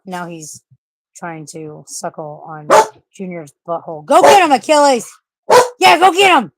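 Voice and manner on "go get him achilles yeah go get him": much higher, loud cheering yell; excited shout. Real, not acted. Owner cheering her dog on to chase the cat, loud high shout. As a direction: egg the dog on at full voice.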